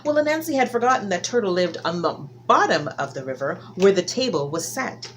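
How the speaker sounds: ordinary delivery, nothing marked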